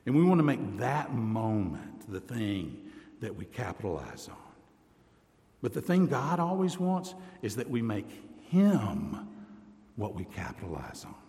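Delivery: normal talking voice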